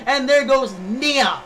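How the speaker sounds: shouting, excited live play-by-play; race commentator. Acted. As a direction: call the race at full hype.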